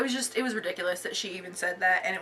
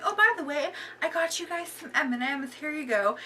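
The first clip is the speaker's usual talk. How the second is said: very high, syrupy mock-nice voice; fake sweet; 8 semitones higher than usual. Manager handing over candy after yelling, very high fake-nice chirp. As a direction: chirp it like nothing happened.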